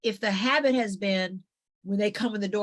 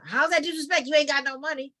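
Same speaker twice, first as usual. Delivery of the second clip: higher, louder taunting jab; mocking relative; 7 semitones higher, 5 dB louder than usual. Relative taunting the daughter about money, mocking. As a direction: needle her about having no money.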